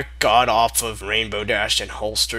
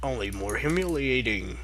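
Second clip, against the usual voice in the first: ordinary delivery, nothing marked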